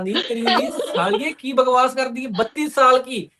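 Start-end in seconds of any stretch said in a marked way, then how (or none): none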